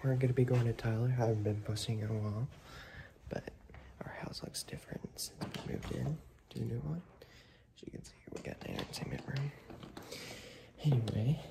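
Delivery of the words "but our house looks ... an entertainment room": hushed whisper, keeping it down indoors. Real, not acted. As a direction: breathe the words so no one wakes. Whispering inside the new house.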